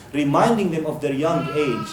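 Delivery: normal talking voice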